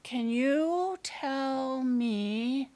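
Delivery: very slow, stretched-out question; slow, searching lead-in to a question; drawn-out stalling. Acted. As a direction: stretch every word while buying time. Child stalling over a question.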